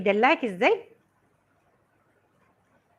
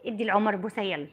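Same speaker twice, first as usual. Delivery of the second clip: soft coaxing; 5 semitones lower, 8 dB quieter than usual. Real, not acted. Mother urging her daughter to kiss Omar, soft coaxing. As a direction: coax her quietly to give a kiss.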